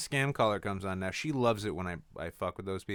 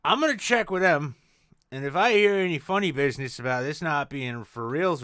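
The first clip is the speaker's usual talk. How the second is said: loud mock threat; 4 semitones higher, 6 dB louder than usual.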